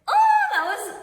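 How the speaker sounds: high, loud squeal of joy; excited. Real, not acted. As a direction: burst out with thrilled laughter. Thrilled about first baby kick.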